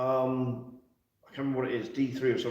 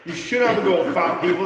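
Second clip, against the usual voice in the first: mock outrage; 7 semitones higher, 8 dB louder than usual.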